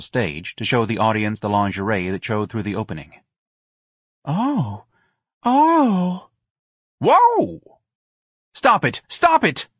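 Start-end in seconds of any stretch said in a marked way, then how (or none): 4.3-6.3 two high, drawn-out ohs, second louder; put-on rising moans; stagey sighs. A narrator's reading. Darlene's sighs during her act. 7.0-7.8 high, loud whoa whoop; big astonished holler; janitor's whoop. Acted. 8.5-9.7 loud, high shriek, climbing on the repeat; shrieking command; screamed order. A narrator's reading. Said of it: "Lana screamed".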